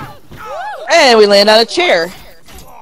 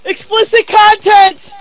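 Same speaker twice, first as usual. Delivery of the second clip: high-pitched cry of alarm; mock-shocked warning; 8 semitones higher, 4 dB louder than usual. Real, not acted. Player warns about the brutal game scene, mock-shocked.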